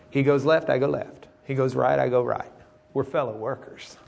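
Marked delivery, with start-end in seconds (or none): none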